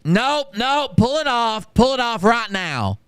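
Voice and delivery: high, slow mocking bark of an order; high-pitched mock shout, drawn out; mock loud order. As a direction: imitate the kid suddenly announcing it to the world. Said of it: "suddenly much louder for some unknown reason".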